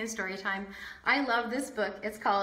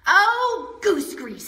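dismayed exclamation; 8 semitones higher, 8 dB louder, slower than usual. Acted. Sophie exclaims.

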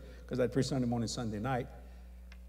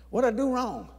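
high, loud worried question; mock alarm; 8 semitones higher, 5 dB louder than usual. Preacher told he must meet God, alarmed high question. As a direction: plead innocence with a raised voice.

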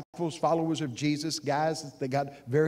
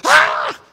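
much higher, much louder mock panic shriek; panicked scream; 16 dB louder than usual. Preacher mocks a panicking Jesus with a wild scream. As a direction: shriek like you've lost it. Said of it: "get up and go".

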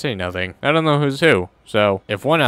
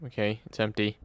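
normal talking voice

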